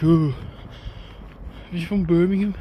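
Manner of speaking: lightly mimicked West Midlands accent. Mocking where someone is from, imitation West Midlands accent. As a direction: put on a bit of Birmingham accent. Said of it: "all of the West Midlands pretty much".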